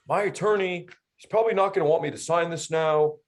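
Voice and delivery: timid buyer hiding behind his lawyer; mocking the buyer.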